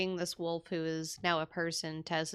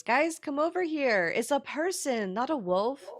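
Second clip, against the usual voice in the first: high, loud singsong call to friends; excited calling out; 10 semitones higher, 5 dB louder than usual. Acted. Tess calling the group over to see the stranger, excited shout. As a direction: call them over in amazed, high-pitched delight.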